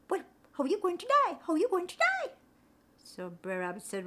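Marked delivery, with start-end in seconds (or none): 0.1-2.3 much higher, sing-song greeting in island lilt; spider Anansi. Acted. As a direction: chirp the greeting, concerned.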